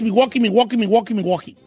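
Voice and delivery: "Milwaukee" chanted four times in a row as "mi-WOH-kee"; repeated drill. Shown as a demonstration. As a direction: rattle off "Milwaukee" like a pronunciation drill. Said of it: "Milwaukee dos veces".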